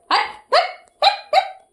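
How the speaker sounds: very high sharp shoo; high-pitched hut hut to chase it. Real, not acted. Shooing a bird away.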